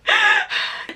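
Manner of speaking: sharp high gasping sob; imitated crying gasp, very high; sobbing gasp. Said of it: "they could just literally hear me crying".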